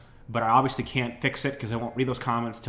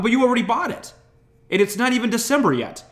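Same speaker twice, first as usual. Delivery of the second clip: much higher, louder protest; exasperated; 11 semitones higher, 6 dB louder than usual. Retold or imitated. Son protesting to dad, high exasperated shout. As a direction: plead in disbelief, voice climbing. Arguing with dad over gift.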